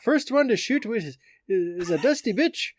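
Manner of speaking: hammed-up mock Irish accent as Conor. Host quoting McGregor's billboard to co-host, mock Irish accent. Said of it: "I wish I could say it with an Irish accent".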